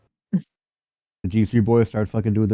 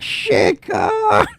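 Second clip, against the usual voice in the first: texted shout. Acted. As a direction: holler it.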